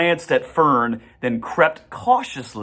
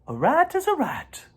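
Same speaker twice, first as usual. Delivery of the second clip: high, gentle spider voice; a kindly spider speaking up; spider's voice. A narrator's reading. Charlotte greets the goose family in a high voice.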